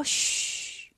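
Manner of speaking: soft voiceless "shh"; a long, quiet shush; hushing shush. Acted. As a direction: hush them quietly. Wukong hushes his companions.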